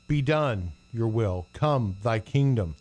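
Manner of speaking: slow, with "done" and "Come" stressed; deliberate stress on "done" and "Come"; emphasis on BE DONE and COME. Shown as a demonstration. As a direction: lean on "Be done" and "Come", letting the rest fall away. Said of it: "The emphasis is on "be done".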